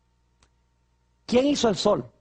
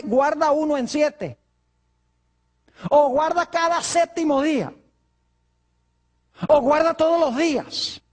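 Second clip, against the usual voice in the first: objecting churchgoer; 4 semitones higher, slower than usual. Retold or imitated. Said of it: "Algunas personas dicen".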